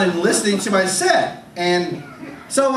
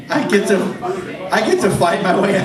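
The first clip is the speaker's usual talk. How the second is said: ordinary delivery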